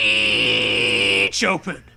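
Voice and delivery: low, slow screeching voice; screechy voice. Acted. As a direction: screech the words like doors. Sound effect on words.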